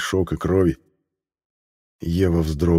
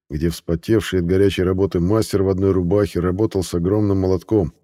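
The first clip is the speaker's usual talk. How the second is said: plain reading voice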